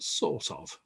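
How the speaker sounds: "sort of" in an English accent, soft non-rhotic R; English-accented "sort of", R barely sounded. Shown as a demonstration. Teacher showing the English way of saying "sort of". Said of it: "in an English accent".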